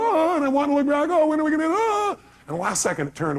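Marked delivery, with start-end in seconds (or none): 0.0-0.3 high, drawn-out "ah"; re-enacted cry before a crash; mock panicked scream. 0.3-2.2 high-pitched, strained re-enacted fright; hammed-up panic rising to a cry; mock panic. Re-enacts panic while head is pulled.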